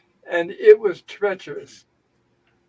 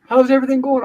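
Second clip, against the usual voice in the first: high, louder singsong greeting; cheery, bubbly; 7 semitones higher, 5 dB louder, faster than usual. Retold or imitated. X-ray doctor greeting a suffering patient, mock bubbly. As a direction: imitate an over-bubbly doctor breezing in. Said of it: "All cheering, bubbly".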